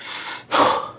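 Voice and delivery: mimicked heavy exhale; acted winded sigh, no voice; imitated out-of-breath puffing. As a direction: puff out air as if winded from climbing steps. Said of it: "they can walk up a couple flights of steps".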